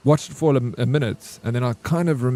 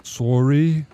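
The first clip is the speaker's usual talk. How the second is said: Canadian sorry; 4 dB louder than usual. Pretend. Playing the polite Canadian to his friend, in a mock Canadian accent. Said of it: "Start practicing your Canadian".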